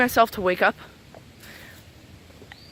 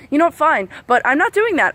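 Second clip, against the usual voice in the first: fed-up retort; 6 semitones higher, 4 dB louder than usual. Retold or imitated.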